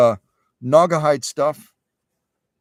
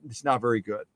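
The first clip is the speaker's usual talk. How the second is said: normal talking voice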